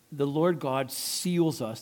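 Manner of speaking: ordinary talk, nothing marked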